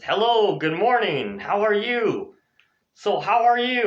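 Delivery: high, sing-song greeting; cheery greeting. Shown as a demonstration. Teacher modelling how to start an interview, cheery greeting. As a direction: lilt it up like a keen greeter.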